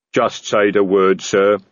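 slow troll rumble; steady and ready; troll Detritus. A narrator's reading. Detritus to Vimes, slow and steady.

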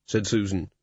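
ordinary delivery

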